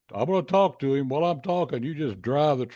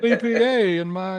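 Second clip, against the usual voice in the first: 1 laughing through words; 8 semitones higher, 6 dB louder than usual. Real, not acted.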